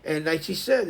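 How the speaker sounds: ordinary talk, nothing marked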